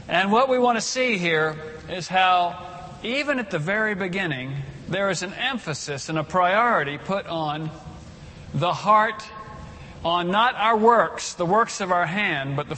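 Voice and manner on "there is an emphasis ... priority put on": ordinary talk, nothing marked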